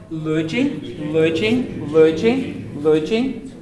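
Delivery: luging with a dental L; tongue on the teeth for the L in luging. Shown as a demonstration. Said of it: "It's the dental L".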